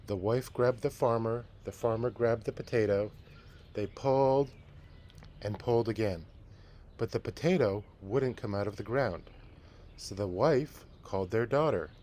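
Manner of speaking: lively read-aloud storytelling voice; story narration. A narrator's reading. Teacher reading a picture book to the class, storytelling.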